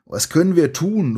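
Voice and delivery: high, horrified call; horrified cry. A narrator's reading. You asking Sandy for help, high horrified call. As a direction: cry out in alarm, pitched high. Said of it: "rufst du entsetzt".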